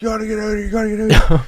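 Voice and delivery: very high, strained shouting voice; mock frantic warning. One guy urges Jeff to get out, high frantic shouting. Retold man urging Jeff to leave.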